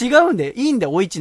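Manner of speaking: loud firm protest; insistent. Real, not acted. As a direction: protest it loudly. Protesting to listener.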